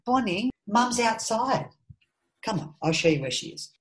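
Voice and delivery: calling to daughter. Real, not acted. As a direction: coax the little one gently.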